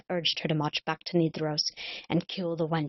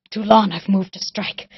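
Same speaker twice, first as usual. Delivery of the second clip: louder, bitter and fierce; vengeful outburst; 3 semitones higher, 9 dB louder than usual. Acted. Cullen to the sleeping king, louder vengeful outburst. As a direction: spit out her years of hatred.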